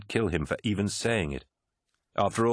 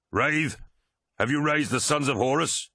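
calling out; 8 semitones higher than usual. A narrator's reading. World Eater captain calls to his vox-man, raised voice. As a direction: call across the dark to Wraith. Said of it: "He raised his axe and called".

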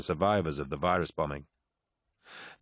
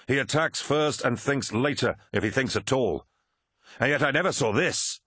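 raised, vehement warlord voice building in exasperation; angry Horus, commanding; 3 semitones higher than usual. Acted. Horus rounding on his Mournival son, angry. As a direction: rail at your own failure to foresee it.

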